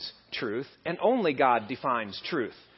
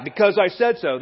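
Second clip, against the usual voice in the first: loud, raised parental decree; insistent mock-scolding, pitched up; emphatic mother; 5 semitones higher, 7 dB louder than usual. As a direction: imitate a mom laying down the law. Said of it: "Does she say that all the time?".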